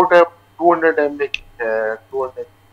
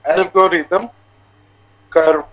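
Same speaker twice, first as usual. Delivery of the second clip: voice breaking up; 3 semitones higher, 6 dB louder than usual. Real, not acted. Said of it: "आपकी आवाज़ कट रही है [name]".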